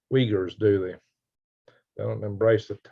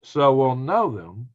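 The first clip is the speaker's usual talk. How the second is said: mock solemn blessing; 5 semitones higher, slower than usual. Reads a joke blessing from chat.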